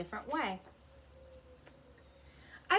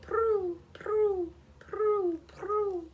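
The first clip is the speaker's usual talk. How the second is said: high, louder pigeon coos; pigeon calls; 10 semitones higher, 6 dB louder, much slower than usual. A narrator's reading. Pigeons begging to be fed, high cooing. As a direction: coo it high like the park pigeons. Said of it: "They say".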